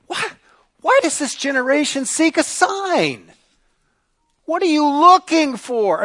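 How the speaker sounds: high, loud, pained questioning; exasperated plea. Acted. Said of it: "he sighs deeply in his spirit and he says".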